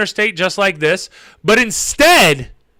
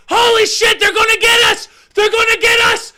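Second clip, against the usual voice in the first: Donnie panicking. Retold or imitated. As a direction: imitate a terrified passenger screaming. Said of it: "worried that they're gonna get us".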